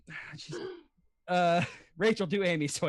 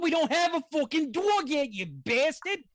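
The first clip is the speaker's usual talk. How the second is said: higher, heated Brooklyn rant; Brooklyn accent; 5 semitones higher than usual. Acted. Impressionist asked for New York, heated Brooklyn accent. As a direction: rattle off an irritated New York tirade. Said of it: "Brooklyn accent".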